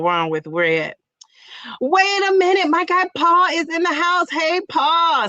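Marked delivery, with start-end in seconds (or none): 1.8-5.3 excited greeting. Real, not acted. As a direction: announce him like a guest arriving.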